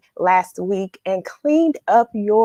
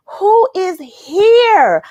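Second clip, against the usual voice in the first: excited call-out; 9 semitones higher, 7 dB louder, much slower than usual. Real, not acted. Calling out to the live audience.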